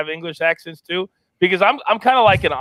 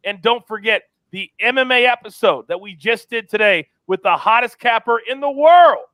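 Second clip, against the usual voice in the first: higher, punchy announcer sign-off; show sign-off; 6 semitones higher than usual. Acted. Host signs off to listeners, higher, punchy announcer style. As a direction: sell the show like a hype host.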